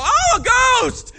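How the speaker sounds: high, loud, slow terrified cry; frightened shriek. Retold or imitated. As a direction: shriek it like you saw a ghost. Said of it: "Angie starts crying like a girl".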